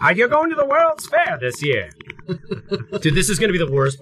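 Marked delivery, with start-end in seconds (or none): 0.0-1.9 put-on high, old-timey dandy voice; hammy stage actor. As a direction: ask it like a turn-of-century gent. Old-timey gentleman bit.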